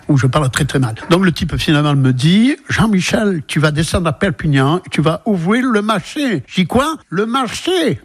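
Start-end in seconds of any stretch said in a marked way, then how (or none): 2.7-6.4 a hint of American accent on every word. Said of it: "faites-nous-le avec l'accent". 6.7-8.0 high, sharp "Quoi?" then a puzzled "Le marché?"; retold stunned surprise, much higher; startled disbelief. Shocked at boss's offer.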